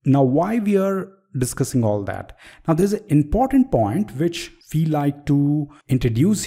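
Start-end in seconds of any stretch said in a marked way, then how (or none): none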